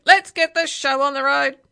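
bossy parrot. A narrator's reading. Parrot takes charge of the bus, loud and bossy. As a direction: call it out like a pushy bird. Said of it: "it said".

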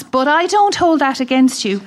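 usual speaking voice

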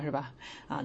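ordinary talk, nothing marked

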